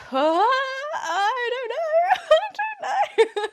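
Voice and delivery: high, slow, half-laughing half-crying; cracking into helpless laughter; laughing nervously. Retold or imitated. Date asks if she's okay.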